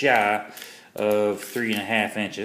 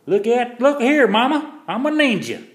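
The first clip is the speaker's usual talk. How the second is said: much higher, louder mock-triumphant show-off; playful ninja boast; 13 semitones higher, 6 dB louder than usual. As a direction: crow like a kid who just landed a trick. Shows off knife flip, playful boast.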